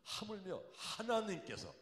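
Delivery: high, loud and slow climactic shout; preacher's shout. Acted. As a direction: thunder the promise at the congregation. Climax of quoted scripture. (높고 크게 외치는 설교 절정)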